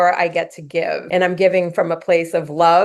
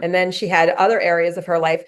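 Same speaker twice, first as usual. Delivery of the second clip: ordinary talk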